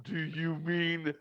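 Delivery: slow, dopey imitation of a grinning friend; mock-dopey slow question. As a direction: drag it out like a dazed, grinning kid. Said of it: "this big fucking dopey smile".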